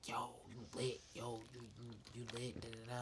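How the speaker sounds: whispered crowd hype, almost breath; hushed quote of hype. Retold or imitated. Retelling friends hyping him up, in a whisper. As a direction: murmur the praise under your breath.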